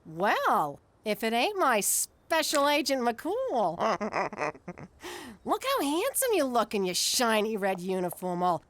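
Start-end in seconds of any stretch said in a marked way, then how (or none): 3.8-5.4 soft coquettish giggle; flirty giggle. Acted.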